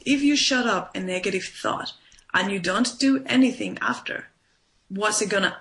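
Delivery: ordinary talk, nothing marked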